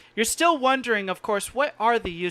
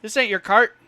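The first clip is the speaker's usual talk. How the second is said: lightly voiced challenge, louder than narration; confronting cart owner; 3 semitones higher, 9 dB louder than usual. Son confronting a stranger at his cart, accusingly. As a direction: warn off a stranger nosing around.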